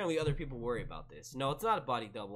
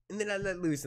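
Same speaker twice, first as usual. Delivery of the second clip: higher comic voice, easing off; movie host impression; 7 semitones higher than usual. Host releasing after his exercise, easing comic voice. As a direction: relax downward after the strain. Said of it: "And he goes".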